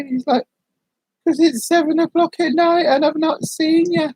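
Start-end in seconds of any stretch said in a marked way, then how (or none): 1.3-4.1 high-pitched mock concern; imitating worried explanation. Rufus to someone who slept all day, imitated worry. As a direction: pitch it up, acting out his worry.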